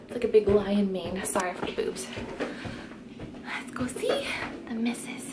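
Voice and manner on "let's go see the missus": hushed whisper. Real, not acted. Sneaking up on sleeping baby.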